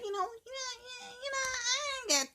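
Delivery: high, whimpering stammer of you knows; mock-sob through a panicky stammer; crying stammer. Acting out someone stammering.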